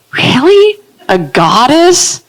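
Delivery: high, slow disbelieving question; mock disbelief, drawn out; high skeptical mock; incredulous. Incredulous reaction.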